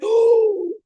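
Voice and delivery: high, loud, swooning oh; thrilled gasp. Retold or imitated. Host hearing his accent, mock swoon. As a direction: gasp and let out a big oh. Retelling her thrilled reaction.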